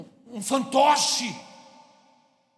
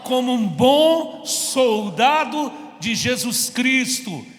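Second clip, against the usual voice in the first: loud slow emphasis; 3 semitones higher, 8 dB louder, much slower than usual. Real, not acted. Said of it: "não ouvi não".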